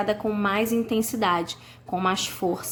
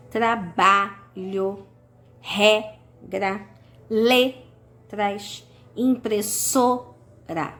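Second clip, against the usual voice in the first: "Trabalho", "regra", "letras", "impressora" com a penúltima sílaba alongada e forte; much slower than usual. Shown as a demonstration. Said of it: "penúltima sílaba é a mais forte". (penúltima tônica e lenta em "Trabalho", "regra", "letras", "impressora")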